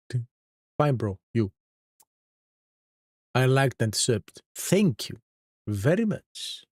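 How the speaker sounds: ordinary talk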